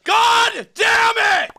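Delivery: slow frustrated yell; angry shout. Real, not acted.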